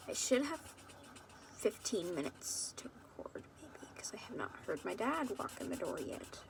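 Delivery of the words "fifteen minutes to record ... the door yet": hushed whisper so dad won't hear; real whisper at a low level. Real, not acted. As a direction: keep it under your breath, secretive. Hiding recording from dad.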